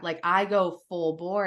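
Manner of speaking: normal talking voice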